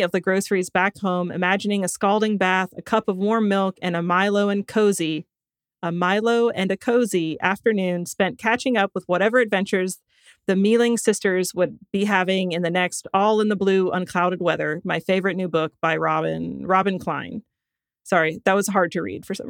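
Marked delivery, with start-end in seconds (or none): none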